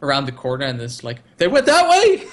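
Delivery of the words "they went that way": very high, loud decoy yell; hammed-up cry to send pursuers off; frantic mock shout. Acts the misdirecting shout.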